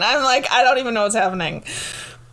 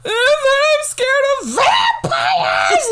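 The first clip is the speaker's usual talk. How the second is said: high, loud mock wail; imitated child's bawling; 5 dB louder than usual. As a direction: wail like a scared little kid, exaggerated. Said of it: "she starts bawling again".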